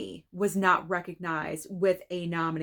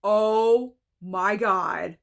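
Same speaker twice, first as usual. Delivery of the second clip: very slow, loud oh my God; stunned disbelief; 5 dB louder, much slower than usual. Real, not acted. Reacting to the Best Actress list, stunned. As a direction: draw it out in real shock.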